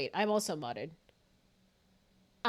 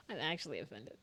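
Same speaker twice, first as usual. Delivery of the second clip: normal talking voice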